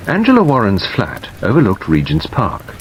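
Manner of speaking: ordinary delivery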